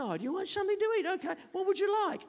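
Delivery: high, quick mock-doting parent; indulgent parent. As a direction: coo to a child, hammed-up. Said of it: "He said".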